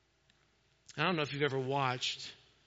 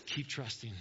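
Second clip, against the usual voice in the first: soft urging; 5 semitones lower, 9 dB quieter than usual. Acted. Soft repeated exhortation.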